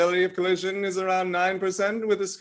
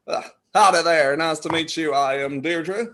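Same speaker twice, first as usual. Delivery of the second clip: put-on low-pitched American howdy accent; mock cowboy accent; 6 semitones lower than usual. Practising an introduction as Deirdre, low mock cowboy accent. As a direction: greet like a hammed-up cowboy, voice dropped. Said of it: "practice my range of accents".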